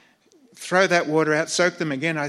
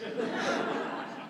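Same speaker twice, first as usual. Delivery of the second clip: breathy laugh; 6 dB quieter than usual. Real, not acted. Laughs at premier's death irony.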